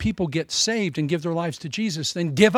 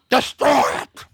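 high, loud hammed-up command; mock preacher shout; 7 semitones higher, 6 dB louder than usual. Peacock preacher ordering the congregation to destroy secular things. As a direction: bark it like a showman preacher.

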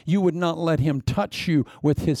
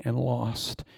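slow whisper; 3 semitones lower, 5 dB quieter, slower than usual. Acted. Sermon climax, dropped voice.

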